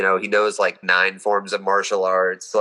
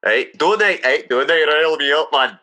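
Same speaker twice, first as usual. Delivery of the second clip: Scottish accent; 5 semitones higher than usual. Shown as a demonstration. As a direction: show off the Scottish jargon. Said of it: "they don't use contractions".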